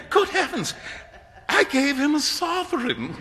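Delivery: very high-pitched aghast exclamation; dismayed cry. A narrator's reading. Young man realises he tipped a millionaire, dismayed. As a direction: wail it in horrified realisation. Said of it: "the picture of dismay".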